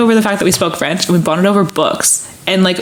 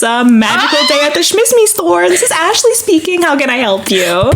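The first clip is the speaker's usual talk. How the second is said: customer service voice; 7 semitones higher than usual. Retold or imitated. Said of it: "I'll be like".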